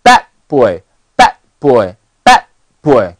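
"bad boy" with the d of bad held silent; loud "bad", tiny stop, straight into "boy"; linked unreleased d. Shown as a demonstration. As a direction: link "bad boy" without releasing the d. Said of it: "连起来".

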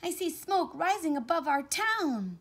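high, loud cry of alarm; loud, high, dismayed character voice; alarmed shout. A narrator's reading. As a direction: shout the bad news to everyone. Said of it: "he shouted".

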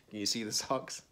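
ordinary delivery, nothing marked

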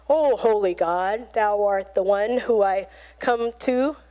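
low mock-reverent prayer; formal solemn prayer. Man praying formally to holy God, mock solemn. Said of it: "He didn't cry out".

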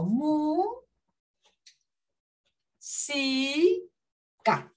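"mu, si, ca" drawn out with long pauses; split into syllables. Shown as a demonstration. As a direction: hold "mu" and "si", then a short "ca". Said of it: "Al separarla en sílaba tengo".

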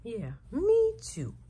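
normal talking voice

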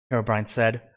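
usual speaking voice

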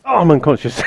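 ordinary talk

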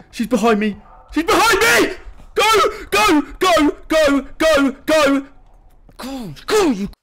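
high, very loud frantic shouts; panicked screaming. Real, not acted. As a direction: scream in terror to get away. Enemy appears behind player.

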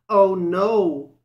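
high, loud cry of alarm; frightened cry. A narrator's reading. Johnny Town Mouse spots the cat, crying out in fright. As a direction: yelp in sudden fright. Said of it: "cried Johnny Town Mouse".

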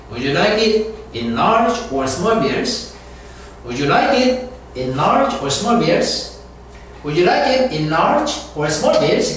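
"large" lifted up, then "small bills" falling away; slow teaching read, "large" up and "small bills" down; rise on large, fall on bills. Shown as a demonstration. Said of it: "in large 올라갔다가 small bills 이렇게 내려옵니다".

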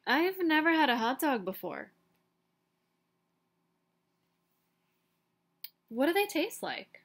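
a lightly voiced, curious little duckling. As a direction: ask innocently, rising up on the question. Duckling asks the pigeon.

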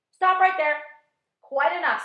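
loud, high outraged shout. A narrator's reading. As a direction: yell it to cut him off. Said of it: "said the earthworm".

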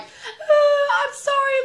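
high, loud imitation wail; mock crying scream. Best friend bursting into the office, crying and screaming sorry. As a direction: wail it out like an exaggerated sobbing friend. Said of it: "just crying, scre-, like".

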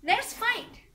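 high, loud challenge; acted challenge. Widow challenges the landowner to a duel, loudly. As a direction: fling out the dare.

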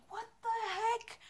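very high, soft, incredulous squeal; retold shock. Woman retelling turning round after being grabbed, high mock-shocked voice. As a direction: replay your disbelief in a thin high voice. Acts her shocked reaction to groping.

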